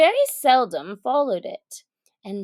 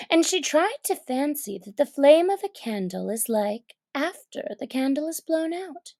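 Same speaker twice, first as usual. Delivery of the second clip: usual speaking voice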